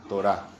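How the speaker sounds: Torá with the stress on its last syllable; accent on final A. Shown as a demonstration. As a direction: say Torá as to-RÁ, dropping in pitch. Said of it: "acento en la A".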